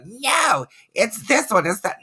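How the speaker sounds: high-pitched mock commenters; sounding off. Host mimics viewers sounding off in the comments, high mock protest. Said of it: "you're probably all sounding off in the comments".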